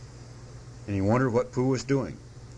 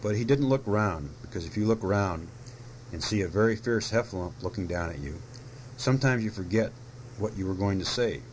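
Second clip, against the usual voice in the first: usual speaking voice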